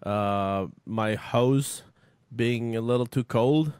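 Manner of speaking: halting apology in an imitation Canadian accent; put-on Canadian accent. Guest host pretends to apologize as a Canadian, put-on Canadian accent. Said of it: "that's a good accent".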